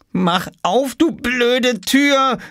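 put-on high, desperate boyish cry; frustrated call. A narrator's reading. Oskar to the stuck door, calling out in frustration. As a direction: stretch the words out in high frustration. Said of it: "rief er".

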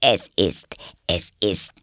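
slow, hesitant, shy small talk; timid. Acted. Rabbit makes small talk beside Alice, timidly. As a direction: start haltingly, timidly. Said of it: "sagte eine schüchterne Stimme".

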